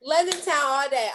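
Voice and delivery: ordinary talk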